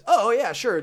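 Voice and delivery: high, loud breezy sure; high mock performer reply. Performer agreeing to let him in, high mock voice. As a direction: toss it off in a high silly voice.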